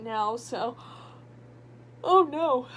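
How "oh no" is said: sleepy yawn while talking; words stretched through a yawn. Real, not acted. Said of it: "and I'm already yawning".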